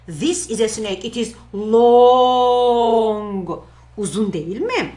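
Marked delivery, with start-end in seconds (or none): 1.0-3.5 slow and loud, long drawn out; stretched for long. Shown as a demonstration. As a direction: stretch the words out to show how long it is. Teacher acts out long snake.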